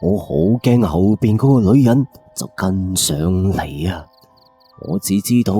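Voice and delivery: ordinary delivery, nothing marked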